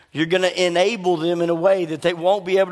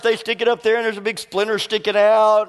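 ordinary delivery

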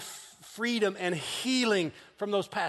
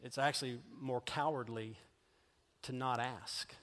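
hushed reassurance; 4 semitones lower, 6 dB quieter than usual. Real, not acted. Quiet pastoral reassurance.

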